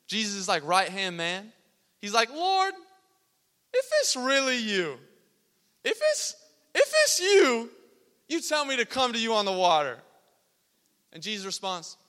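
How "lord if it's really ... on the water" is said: high, loud shout across the waves; hammed-up, strained high call; acted calling out. Peter to Jesus from the boat, calling out high.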